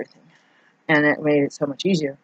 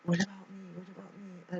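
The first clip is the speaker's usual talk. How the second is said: higher, much softer mock robot whine; Joyce Meyer's robot; 3 semitones higher, 18 dB quieter than usual. Telling the viewer her own self-pity, a soft higher mock robot whine. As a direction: quietly mimic a self-pitying robot, pitched up. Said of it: "Joyce Meyer's robot".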